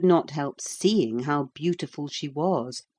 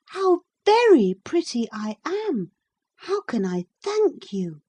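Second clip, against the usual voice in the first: put-on high, louder delighted girl; delighted princess; 11 semitones higher, 5 dB louder than usual. A narrator's reading. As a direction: marvel at her reflection. Princess sees new face.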